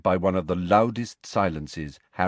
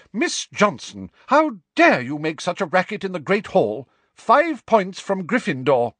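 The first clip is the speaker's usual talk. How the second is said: high, loud indignant reprimand; stern scolding; 11 semitones higher, 5 dB louder than usual. Acted. Professor scolds a shouting pupil.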